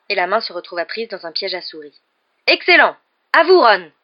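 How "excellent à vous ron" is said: high, loud praise and summons; cheer, then call the next pupil up. A narrator's reading. Teacher praises, calls next pupil. (félicitation lancée haut et fort)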